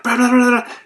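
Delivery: high, loud mock chatter of the wife; mock nagging. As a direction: parody her nagging, pitched high. Mocking wife's nagging.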